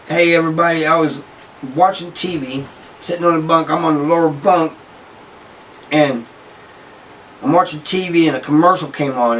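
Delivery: imitating a cellmate yelling to the wing; retold yelling. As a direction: announce it to everybody, mock shouting. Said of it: "yells out".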